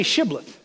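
Shibboleth with an opening "sh" sound; correct Shibboleth. Acted. Gileadites demanding the password, Shibboleth with a clear "sh". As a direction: say Shibboleth starting on "sh". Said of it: "They couldn't say".

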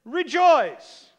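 loud, ringing single word; booming proclamation. Retold or imitated. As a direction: proclaim it to the rafters. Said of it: "The dude that had real trouble said".

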